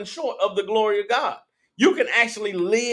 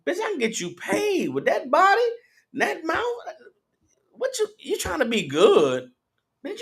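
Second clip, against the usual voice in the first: higher-pitched taunting pimp voice; preacher voicing a pimp recruiting a woman, higher and mocking; pimp talk; 7 semitones higher than usual. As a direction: scoff at her for working a job, pimp-style. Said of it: "That's how they talk".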